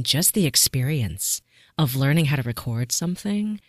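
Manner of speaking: ordinary delivery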